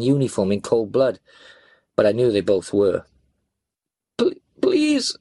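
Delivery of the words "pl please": high, faltering, stammered plea; frail pleading. A narrator's reading. Beaten policeman breaking into the argument, frail pleading. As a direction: beg weakly, stumbling on the word. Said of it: "the policeman's frail voice broke into".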